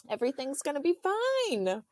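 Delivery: high-pitched mock reassurance; imitating reassuring husband. Husband promising pregnant wife all is fine, high mock reassurance.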